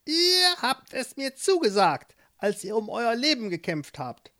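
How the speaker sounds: put-on high, sly old witch. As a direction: play the witch, curious and pleased. Witch voiced in story.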